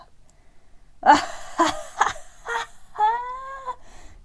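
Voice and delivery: big high loud belly-laugh guffaw; frog guffawing. Acted. Frog laughing at Terence's jump, high loud guffaw. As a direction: roar with laughter at the startled bird. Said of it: "guffawed the frog".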